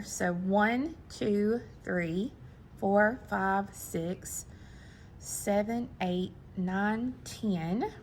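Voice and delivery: soft count to oneself, fading to a near-whisper; counting under breath. Real, not acted. Crafter counting beads as she picks them up, under her breath. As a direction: murmur the numbers while keeping track.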